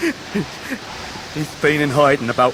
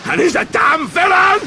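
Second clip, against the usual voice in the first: furious shout. Acted. Ham cursing the man who took Emily, a furious shout. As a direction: spit out the curse at full force.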